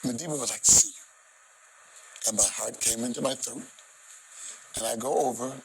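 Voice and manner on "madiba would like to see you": near tears. Real, not acted. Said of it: "Please forgive me if I cry".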